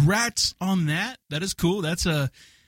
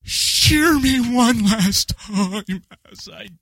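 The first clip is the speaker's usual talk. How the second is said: William Shatner, dying sheep; much slower than usual. Pretend. Co-host performing on command, hammed-up dying sheep impression. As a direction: ham up a death scene in broken bleats. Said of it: "William Shatner, dying sheep, go".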